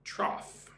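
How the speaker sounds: "Trough" as troff, quiet and careful. Shown as a demonstration. Writing the word down for students, modelling it softly. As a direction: model "Trough" with a short o and a final f sound. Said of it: "Say it like this".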